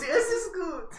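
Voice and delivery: high, hammed-up German "Es ist gut"; mock German. Disguised player repeats his German line, pitched high. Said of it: "You're German now".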